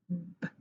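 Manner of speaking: B, lips closed then released softly; voiced b sound. Shown as a demonstration. Teacher showing the mouth for the b sound. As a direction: say B, a soft voiced stop b. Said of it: "notice about my mouth".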